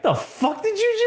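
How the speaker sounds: high, squeaky mock tough guy; street thug. Thug snapping at Cole North, high mock sneer. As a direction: sneer it like a punk picking a fight.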